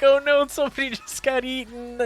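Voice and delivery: high-pitched panicky wail, half laughing; mock terrified kids. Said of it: "and they're terrified".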